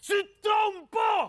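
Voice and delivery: very high, slow shout; shouting to be heard. Acted. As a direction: holler it out, stretching each word. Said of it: "Parle plus fort, Mathieu".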